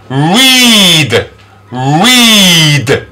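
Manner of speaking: long ee in Read; E-sound demonstration. Shown as a demonstration. Teacher models E A sound, Read with long ee. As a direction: stretch out each Read. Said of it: "which is E".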